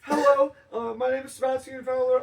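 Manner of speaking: high, loud nervous caller voice. Pretend. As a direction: squeak it out like a scared caller on a hotline. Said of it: "make the nine one one call".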